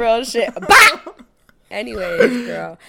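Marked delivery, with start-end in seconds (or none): 0.5-1.1 loud high bang sound; mock gunshot. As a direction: blurt a gunshot noise. Gunshot imitation.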